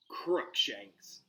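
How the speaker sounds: usual speaking voice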